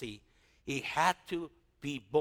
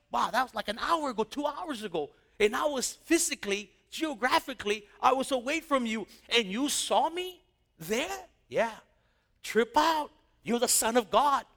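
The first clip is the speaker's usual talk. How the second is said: higher excited amazement; giddy; 6 semitones higher, 3 dB louder than usual. Retold or imitated. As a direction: marvel out loud, thrilled. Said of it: "He got all giddy".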